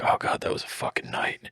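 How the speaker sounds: whispered, spent; exhausted whisper. Acted. Festival goers after the night, whispering tiredly. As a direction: breathe it out wiped out.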